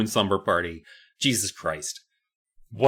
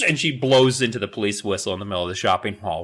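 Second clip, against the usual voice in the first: ordinary delivery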